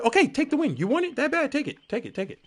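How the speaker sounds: high mocking go ahead, take it; sarcastic offer of the win; taunting. Mocking survivors who want a cheap win.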